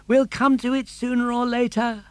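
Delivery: put-on high, bright puppet boy voice; Pinocchio. Puppet boy urging his father, high and eager.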